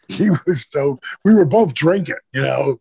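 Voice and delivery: usual speaking voice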